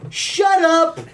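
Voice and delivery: high, slow indignant rant; child snapping at parents who correct homework, mock angry. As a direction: whine back at the parents in mock outrage.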